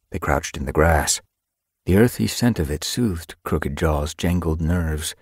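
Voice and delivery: usual speaking voice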